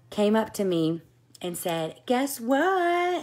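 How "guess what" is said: much higher, louder, bursting to tell; teasing "Guess what?" squeal; excited news. Retold or imitated. Consultant springing news on her, excited and high.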